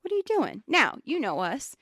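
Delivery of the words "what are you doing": higher, lightly voiced boss scolding a rep; a touch of a snippy manager, pitched up; mock condescending boss. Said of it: "He's telling her".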